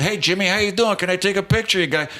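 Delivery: high, quick breezy request; eager fan. Retold or imitated. Friend with camera asks his idol for a picture, eager. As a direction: bounce up to the star, pitched high. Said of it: "comes walking in and says".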